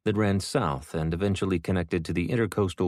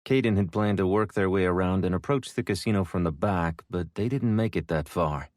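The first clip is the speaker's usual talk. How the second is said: ordinary delivery, nothing marked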